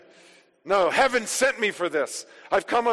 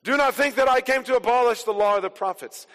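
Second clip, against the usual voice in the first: firm, louder denial; emphatic quote of Jesus; adamant denial; 5 semitones higher, 5 dB louder than usual. Acted. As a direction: deny it with full force. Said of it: "he says with the most adamant denial".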